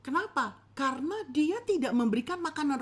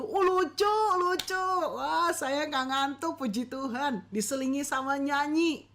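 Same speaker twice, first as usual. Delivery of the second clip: amused listener; 4 semitones higher than usual. Retold or imitated. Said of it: "Ya, lalu".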